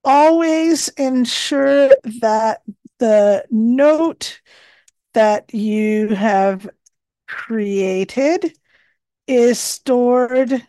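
slow, measured dictation voice; dictating. Acted. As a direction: dictate each phrase carefully. Dictating tip into the note.

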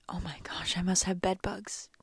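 hushed, horrified whisper to herself; breathless whispered dread; panicked whisper. Retold or imitated. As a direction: whisper it like you're scared someone hears. Panicked thought about bed bugs.

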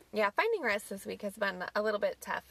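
ordinary talk

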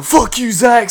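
high, loud yelled insult; mock yell. Retelling the friends yelling at Zach as they drive off. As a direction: shout it out the window at him.

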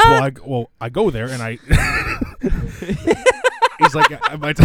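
real laughter breaking into the telling; cracking up mid-joke. Real, not acted. Joke teller losing it before the punchline, laughing helplessly. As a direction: try to go on and collapse laughing. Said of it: "you started laughing at Rectum Stretcher".

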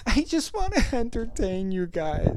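high, plaintive put-on voice; mock tearful. Podcaster apologising to listeners for a bad show, mock tearful. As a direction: ham up a pleading apology.

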